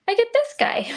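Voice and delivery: excited. Real, not acted. Playful reveal of the cut piece.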